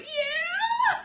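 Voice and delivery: very high squeak fading to tiny; overwhelmed fangirl voice, retold; starstruck squeal. Starstruck fan, flushed and speechless.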